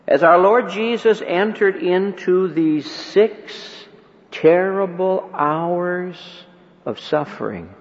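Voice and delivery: ordinary talk, nothing marked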